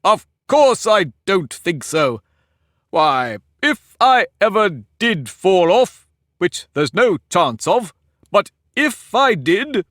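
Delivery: high, slow, lordly Humpty Dumpty voice; pompous egg lecturing a girl; haughty egg. Acted. Humpty boasting grandly.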